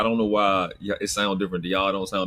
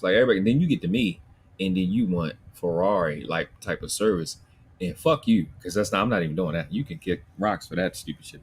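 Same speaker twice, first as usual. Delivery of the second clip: normal talking voice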